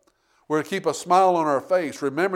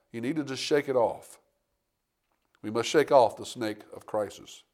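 ordinary talk, nothing marked

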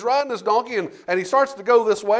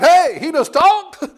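mock astonishment; 8 semitones higher, 8 dB louder, much slower than usual. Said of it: "Balaam doesn't think about the fact".

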